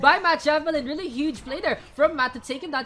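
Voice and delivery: excited esports casting; play-by-play. Acted. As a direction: call the action fast and loud.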